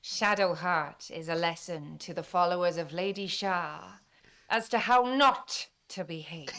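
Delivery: lightly voiced Welsh accent for Viconia; a scornful dark elf in a Welsh accent. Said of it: "Talk to Shadowheart as, in a Welsh accent".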